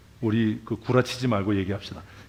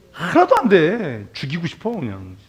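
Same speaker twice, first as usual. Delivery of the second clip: exasperated scoff; 5 semitones higher, 6 dB louder than usual. Real, not acted. Preacher vents about false comfort.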